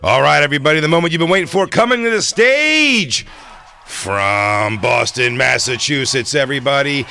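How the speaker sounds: loud high hype announcer; stage announcer. Pretend. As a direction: boom out the big introduction. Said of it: "please introduce me right now on stage".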